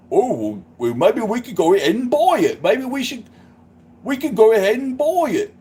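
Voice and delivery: broad mock-English granddad voice; English granddad accent. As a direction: swoop high as an old English granddad. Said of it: "I'll do the granddad".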